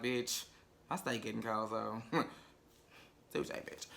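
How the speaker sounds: soft, low muttered aside fading to a whisper; mock aside. Voicing two men ignoring the brawl over dinner, soft aside. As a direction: voice the couple's side-eye quietly. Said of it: "looking at each other like".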